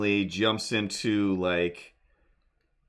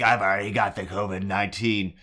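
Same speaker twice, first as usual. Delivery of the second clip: imitating kid; 3 semitones lower, faster than usual. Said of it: "screaming at me".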